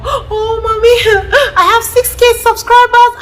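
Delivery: put-on high, loud, excited kid voice; excited child. Child telling mother about subscribers, high and excited. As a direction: squeal the news like a proud kid. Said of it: "Your child will come".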